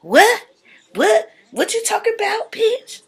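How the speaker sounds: very high disbelieving squeal, quickening; shocked Kat. Retold or imitated. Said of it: "Kat like".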